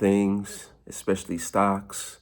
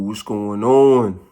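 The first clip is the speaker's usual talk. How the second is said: hyped greeting; 9 dB louder, slower than usual. Real, not acted. Vlogger's loud greeting to viewers.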